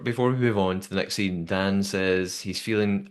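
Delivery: normal talking voice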